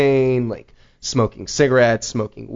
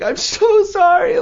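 high, slow, wailing apology; a hammed-up sob of sorry, much higher; imitating a man crying his apology; mock sobbing; 4 dB louder, slower than usual. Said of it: "bawling, crying, like".

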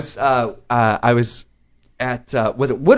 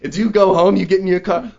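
ordinary talk

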